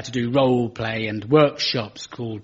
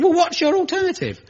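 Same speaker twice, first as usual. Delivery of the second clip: hammed-up smug retort; mocking opponent. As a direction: parrot the smug debater. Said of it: "They say".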